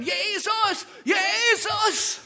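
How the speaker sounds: high, loud cry for help across a crowd; a blind beggar yelling from the roadside; desperate shout. Retold or imitated. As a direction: scream the name as if everything depends on it. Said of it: "fängt er an zu schreien".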